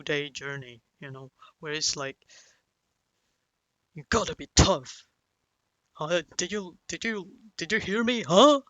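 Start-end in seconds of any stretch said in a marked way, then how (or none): 3.9-8.6 mock scolding, building louder to a high "huh?"; berating father. Father lecturing his kid on the journey, scolding. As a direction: imitate a pissed-off parent dispensing advice. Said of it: "berating or scolding you".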